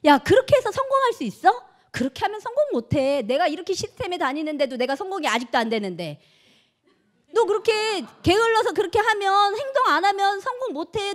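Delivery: loud, high nagging scold; imitated angry nagging at a partner. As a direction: berate a lazy partner.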